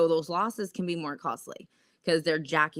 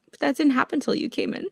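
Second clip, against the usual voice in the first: ordinary delivery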